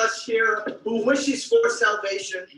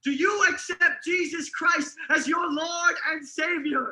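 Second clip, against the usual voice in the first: preaching shout; 7 semitones higher than usual. Acted. Preacher demanding Daniel's acceptance.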